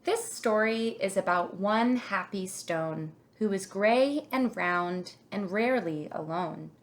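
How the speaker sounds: ordinary delivery, nothing marked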